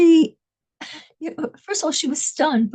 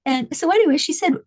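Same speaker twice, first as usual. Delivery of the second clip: normal talking voice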